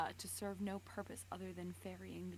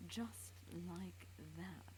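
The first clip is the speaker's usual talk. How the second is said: slow, soft murmur; quiet; 6 dB quieter, much slower than usual. Acted. Vogel soothing Sherlock, slow and soft. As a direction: ease him into it softly. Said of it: "said Vogel quietly".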